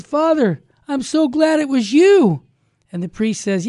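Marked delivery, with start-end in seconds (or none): 0.0-2.4 loud, high, joyful exclamation; acted burst of glad relief; overjoyed gratitude. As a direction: pour out heartfelt thanks at raised pitch. Grateful man hugs the priest.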